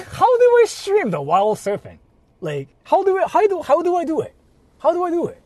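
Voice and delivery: high, loud excited rambling. Real, not acted. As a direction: laugh it out, rising and eager. Excited rant about streaming surfing.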